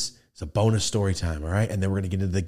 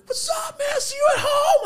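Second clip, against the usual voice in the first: high, squeaky mock cheerful hello; shrill cartoonish call to a kid; hammed-up high voice; mock high greeting; 4 dB louder than usual. Uncle greeting kids at school.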